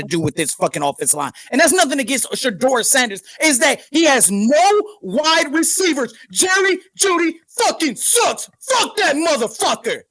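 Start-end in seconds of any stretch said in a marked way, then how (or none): 6.3-10.0 angry shout. Real, not acted. Angry rant about own team.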